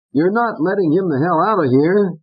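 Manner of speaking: high, pushy puppet voice; Fats. Acted. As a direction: egg Corky on. Fats urges Corky.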